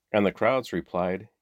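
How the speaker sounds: ordinary delivery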